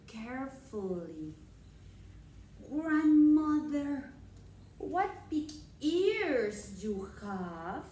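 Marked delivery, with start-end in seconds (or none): 2.7-7.8 put-on loud, very slow wondering girl; Little Red voice. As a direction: stretch it out, amazed.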